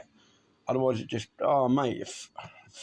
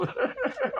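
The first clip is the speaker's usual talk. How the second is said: high laugh. Real, not acted. Laughing at boredom story.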